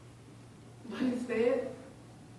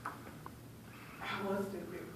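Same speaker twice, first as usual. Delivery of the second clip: very soft, slow, defeated admission; hushed self-blame; 11 dB quieter, much slower than usual. Acted. Bullied child starts believing the insults, quiet and defeated. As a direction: let the voice sink away, leaving long pauses. Victim believes the names called.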